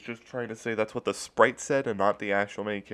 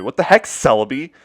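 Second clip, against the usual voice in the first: loud, higher angry outburst; angry yell; 5 semitones higher, 11 dB louder than usual. Retold or imitated. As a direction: blow up at the screen. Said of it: "really mad and yelled at my Game Boy".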